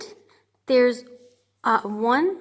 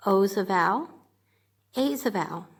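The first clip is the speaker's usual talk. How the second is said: ordinary delivery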